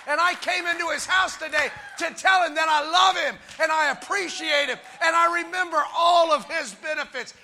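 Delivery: full-voiced, emphatic preacher; fervent preaching. Real, not acted. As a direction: drive each phrase home to the congregation.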